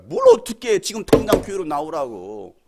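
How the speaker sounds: loud, insistent retort; retold scolding. Pastor telling a hungover caller to come to church, loud scolding.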